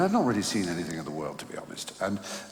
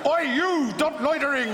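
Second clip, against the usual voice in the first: much higher, louder mock yell at a loiterer; mock shout; 4 dB louder than usual. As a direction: bark it out like a guard on patrol. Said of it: "going things like".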